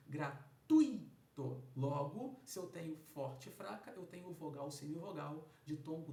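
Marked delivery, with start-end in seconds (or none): 0.1-1.2 Gratuito said slowly and much louder, the U hit strongly; loud, slow classroom demo of Gratuito; stressed U. Shown as a demonstration. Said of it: "um U mais forte do que um I".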